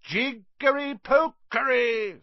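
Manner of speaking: slow, loud, high mock incantation; fierce voice. A narrator's reading. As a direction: cast a fake spell fiercely. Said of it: "said Harry in a fierce voice".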